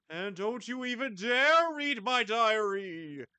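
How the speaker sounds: angry ghost warning. Acted.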